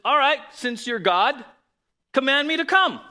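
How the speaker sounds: loud, high acted call; bold challenge. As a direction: call it out across the water. Peter calls out challenge to Jesus.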